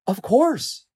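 high, ready assurance; reassuring lions. A narrator's reading. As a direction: answer as if it were obvious. Other lions reassure him.

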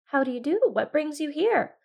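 lightly voiced friendly fox. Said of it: "said the fox".

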